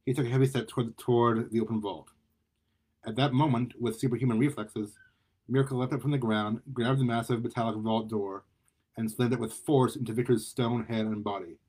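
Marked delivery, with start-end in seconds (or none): none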